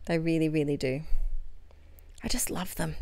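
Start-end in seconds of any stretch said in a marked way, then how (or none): 2.2-3.0 a soft whisper of delight; whispered adoration. Real, not acted. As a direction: whisper it like a secret love. Adoring tiny word stickers.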